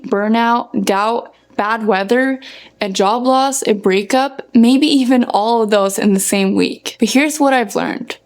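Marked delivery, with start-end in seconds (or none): none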